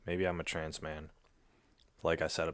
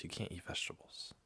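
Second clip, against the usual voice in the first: softer whispered telling-off; whispered scolding; 8 dB quieter than usual. Real, not acted. Whispering to her cat that vegetables are off-limits. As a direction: lean in and whisper the rule.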